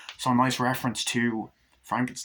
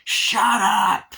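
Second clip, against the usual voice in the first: high, loud drawn-out yell; imitated exasperated shout; Chucky; 10 semitones higher, 9 dB louder than usual. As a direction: bellow it to silence her. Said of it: "Chucky just goes".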